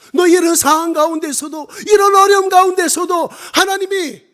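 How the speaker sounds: high, loud, insistent sermon cry; full-force preaching. Real, not acted. God challenges the missionary amid hardship, full-force preaching. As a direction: press each phrase hard.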